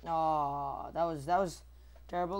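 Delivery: ordinary talk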